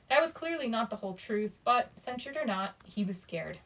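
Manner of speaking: usual speaking voice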